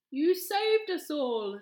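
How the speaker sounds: slow, loud amazed thanks; grateful king. A narrator's reading. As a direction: thank her, overwhelmed.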